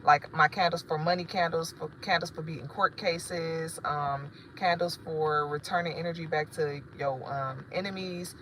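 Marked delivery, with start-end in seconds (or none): none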